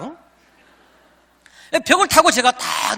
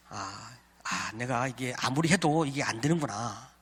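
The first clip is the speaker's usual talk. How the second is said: slow, much softer and lower sigh of defeat; resigned realization; 8 semitones lower, 10 dB quieter than usual. Retold or imitated. Realizing his fainting trick will never work, resigned and hushed. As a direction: let it sink in slowly, giving up.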